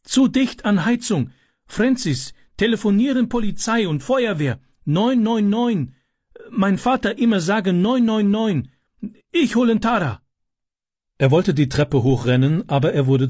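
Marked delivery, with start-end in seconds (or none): 0.0-10.2 calling out. A narrator's reading. Boy tells his friend to fetch help, calling out. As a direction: cry out the alarm word by word. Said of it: "rief er".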